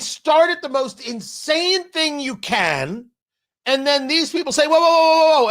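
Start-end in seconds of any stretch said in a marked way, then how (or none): 4.7-5.5 loud, high run of whoas; acted alarm, raised voice; alarmed protest. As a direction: cut in to halt someone going too far. Voices alarmed people backing off.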